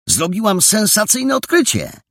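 excited, high-pitched exclamation; beaming announcement. A narrator's reading. Jola reports a sensational discovery, beaming and excited. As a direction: blurt it out high and thrilled. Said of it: "zawołała rozpromieniona Jola".

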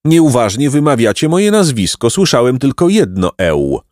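offended pedantic donkey. A narrator's reading. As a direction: correct them with wounded dignity.

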